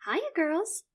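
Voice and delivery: high, bright greeting; cheery chirp. Acted. Mother greeting her children, chirpy. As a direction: sing it out cheerfully. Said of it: "she chirps".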